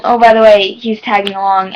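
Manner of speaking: loud, offhand retold announcement; imitating friend. Vlogger requoting friend without the name, loud mock casual. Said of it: "and then be like".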